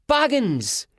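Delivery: put-on high boyish cry; kid's high-pitched shout. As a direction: call it out high in outrage. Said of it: "Sean shouted".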